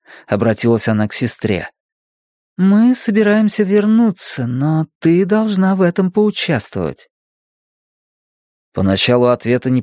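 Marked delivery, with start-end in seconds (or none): 2.6-7.1 higher, urging sister voice; Lizzie's voice. A narrator's reading. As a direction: coax her firmly back. Лизи urges her sister.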